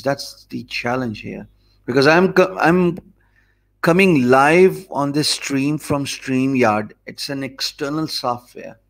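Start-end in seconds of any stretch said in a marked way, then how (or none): none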